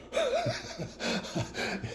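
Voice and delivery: real amused laugh. Real, not acted. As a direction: burst into a soft high laugh. Laughs at own remark.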